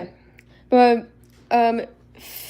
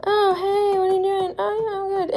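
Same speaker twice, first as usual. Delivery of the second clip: higher, light voicing of toy horses; mock character chat; 10 semitones higher than usual. Shown as a demonstration. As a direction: act out two models chatting. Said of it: "I don't like this".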